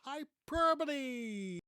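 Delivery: "Hyperbole" hammed up, high, loud and stretched; huge drawn-out cry of "Hyperbole"; exaggerated exclamation. Shown as a demonstration. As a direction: belt out hy-PER-buh-lee with over-the-top drama. Exaggerated demo of word meaning exaggeration.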